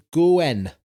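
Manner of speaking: "goen" with a long O, ending in "en"; Welsh vowel change. Shown as a demonstration. Co-host showing Welsh "going", said as "goen". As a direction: say "goen", stretching the O before "en". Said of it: "going is pronounced".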